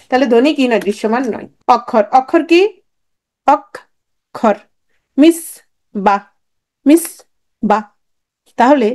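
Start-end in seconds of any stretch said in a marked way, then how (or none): 3.5-4.6 অক্ষর in two separate beats, "ok", long pause, "khor"; split into syllables. Shown as a demonstration. Teacher showing what a syllable is, অক্ষর broken as অক - খর. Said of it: "একসাথে যতটুকু উচ্চারণ করতে পারি". 5.2-7.8 মিস and বা as separate beats, a clear pause between; split into syllables. Shown as a demonstration. Teacher demonstrating single-breath units, মিস - বা syllable by syllable. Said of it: "একসাথে যতটুকু উচ্চারণ করতে পারি".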